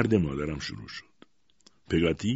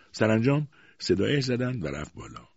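plain reading voice

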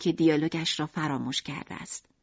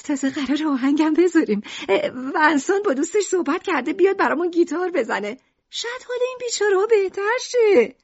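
high, bright-hearted mother's voice; Lucrèce playing the chipper wife; cheerful mother; 9 semitones higher than usual. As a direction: reassure him, pitched high.

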